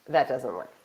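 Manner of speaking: ordinary delivery, nothing marked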